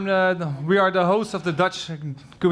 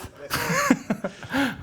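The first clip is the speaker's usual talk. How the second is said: high-pitched laugh at own slip; laughing at yourself; high giggle; 10 semitones higher, 4 dB quieter than usual. Real, not acted. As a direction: giggle at the name mix-up. Laughs after name mix-up.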